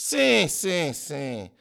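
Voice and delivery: high start, easing off in relief; relieved, falling agreement. A narrator's reading. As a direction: agree repeatedly as tension drains. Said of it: "aliviou Sócrates".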